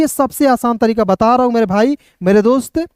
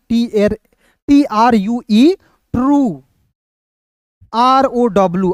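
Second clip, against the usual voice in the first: drawn-out letter dictation; slow spelling out; slower than usual. Shown as a demonstration. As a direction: spell टीआरयूई slowly, letter by letter. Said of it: "उच्चारण". (धीरे-धीरे अक्षर बोलना)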